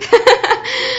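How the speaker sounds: real giggle at herself; a quick, high laugh; sudden bright laugh; high burst of laughter. Real, not acted.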